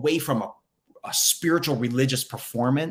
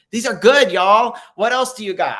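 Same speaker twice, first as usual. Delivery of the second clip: much higher and louder, thrilled with the answers; excited; 8 semitones higher, 9 dB louder than usual. Real, not acted. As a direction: burst out with real delight at the chat. Excited reaction to chat answers.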